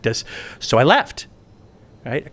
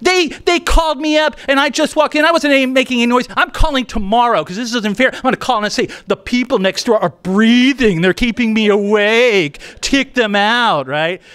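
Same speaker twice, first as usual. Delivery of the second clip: outraged [name]; 8 semitones higher, 3 dB louder than usual. Retold or imitated. [name] upset retelling call.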